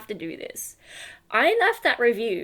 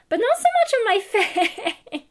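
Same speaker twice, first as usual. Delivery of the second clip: much higher, louder, breaking into a laugh; 12 semitones higher, 5 dB louder, much faster than usual. Real, not acted. Owner laughing about the portrait filter on her face.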